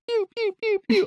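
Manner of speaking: high-pitched repeated "pew" noises; imitated game beeps. Gamer shows co-hosts the noise that annoyed the principal, imitated beeping. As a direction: mimic an annoying game sound effect, very high. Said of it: "the noise was just annoying".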